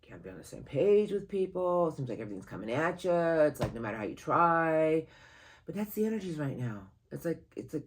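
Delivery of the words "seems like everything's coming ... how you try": ordinary delivery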